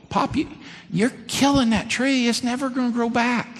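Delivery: put-on high, pleading boy's voice; a kid begging Pop not to cut the tree; young boy protesting. Boy voicing worry to granddad.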